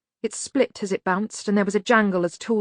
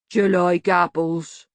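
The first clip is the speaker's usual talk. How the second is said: slow, heavy-voiced simpleton; slow rumble; 4 dB louder, slower than usual. A narrator's reading. Banjo questioning the man he holds, slow rumble. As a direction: rumble it out, word by word. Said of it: "Banjo rumbled".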